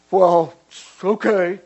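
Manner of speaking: put-on loud, huffy kid giving in; defiant acceptance. Kid given two days' discipline, defiant. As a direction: accept the punishment with a loud huff.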